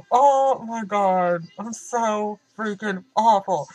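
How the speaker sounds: slow, loud, drawn-out dismay; mock self-horror. Real, not acted. Confessing her lie to viewers, slow loud mock horror. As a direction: draw out each word, appalled at yourself.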